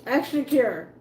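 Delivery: joking wrong Extra cure; mock misreading. Shown as a demonstration. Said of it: "Thought it was extra choir".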